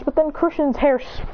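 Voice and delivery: ordinary delivery, nothing marked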